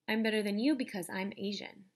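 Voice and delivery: plain reading voice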